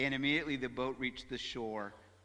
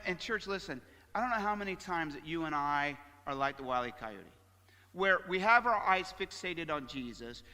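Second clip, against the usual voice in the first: normal talking voice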